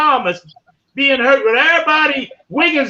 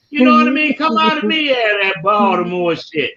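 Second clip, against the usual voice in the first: ordinary delivery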